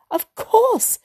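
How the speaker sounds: a sudden delighted exclamation; wife suddenly sees a way out, joyful cry. A narrator's reading. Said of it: "She uttered a cry of joy".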